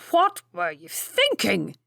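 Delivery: slow, loud stern rebuke; angry. A narrator's reading. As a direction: demand it like an angry duke. Duke rebukes the careless valet.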